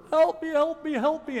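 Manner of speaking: high, loud, pleading cries; desperate begging. Acted. The Canaanite woman begging Jesus, high desperate plea. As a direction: plead again and again, voice rising. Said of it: "She escalated her plea".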